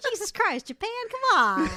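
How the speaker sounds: high, exasperated scolding; exasperated outcry. Real, not acted. Friend scolding Japan's whalers, exasperated and high. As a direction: throw up your hands at Japan.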